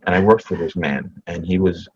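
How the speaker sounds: ordinary talk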